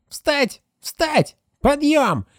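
high shrill shouts of a ghostly chorus; shrill yelling voices. Acted. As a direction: shriek the orders. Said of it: "визгливые голоса всё выкрикивают".

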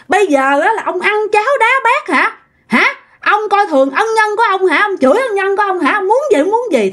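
high-pitched, rapid-fire angry retort; indignant anger. Acted. Insulted neighbour tells off her friend's husband, furious and high-pitched. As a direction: fire back indignantly, demanding an answer. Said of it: "cô Tám mới tức lên".

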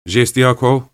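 ordinary delivery, nothing marked

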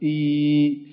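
drawn-out sung "إي"; long vowel demo. Shown as a demonstration. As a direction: hold the "إي" like a sung note. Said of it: "يعني أحرف لها صوت". ("إي" ممدود كالغناء)

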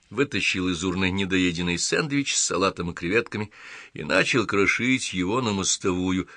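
plain reading voice

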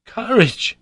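loud, high exclamation; indignant cry. A narrator's reading. Harry echoing his uncle's word, crying out. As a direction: throw the word back loudly. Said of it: "I cried".

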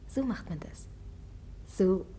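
low unvoiced teasing whisper. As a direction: whisper it close to his ear, mocking. Teasing the prince privately.

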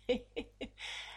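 a voiceless, sneering snicker; breathless evil snicker. Acted. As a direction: snicker in cruel triumph. Villain gloats after threat.

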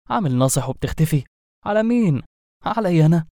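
lightly voiced scolding, pitch jumping up on the taunt; a touch of indignant challenge; mock scolding. Sorcerer tells off the genie for disappearing, mock scolding.

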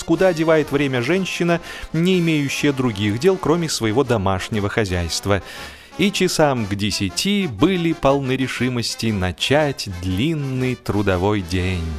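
plain reading voice

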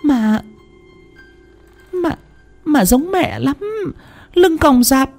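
high, trembling, stammering plea; frightened pleading. A narrator's reading. Wife insisting she saw mother's ghost, high frightened pleading. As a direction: plead desperately to be believed. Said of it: "Thanh phân trần".

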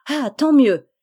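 put-on a clapping girl's loud "tant mieux"; delighted cry. A narrator's reading. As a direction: cry out with joy, clapping hands. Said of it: "s'écria Rose en battant des mains".

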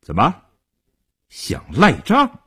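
menacing challenge. A narrator's reading. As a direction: dare the debtor to wriggle out of it. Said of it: "叶成坚恶狠狠地盯着刘少坤".

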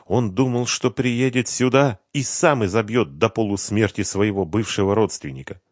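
ordinary delivery

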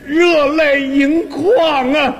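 hammed-up tearful quaver, long drawn-out syllables; milk it like you're welling up with gratitude; moved to tears. Telling his partner how neighbours' kindness moved him, mock-tearful. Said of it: "当时看到这种情景啊".

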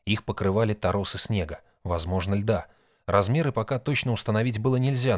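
ordinary delivery, nothing marked

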